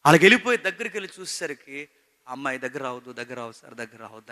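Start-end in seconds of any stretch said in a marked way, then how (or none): 2.6-4.3 soft, fading plea to keep away; a quiet, hurt woman's plea; pleading in distress. Retold or imitated. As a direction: beg him not to come near, softly. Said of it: "అని బాధపడుతుంది".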